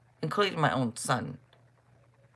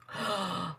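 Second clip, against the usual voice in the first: mock gasp; 7 dB quieter than usual. Kids accuse her, she reacts.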